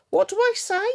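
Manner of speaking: can't believe what she's hearing; exasperated disbelief. Real, not acted. To her live viewers about Orion, high exasperated disbelief.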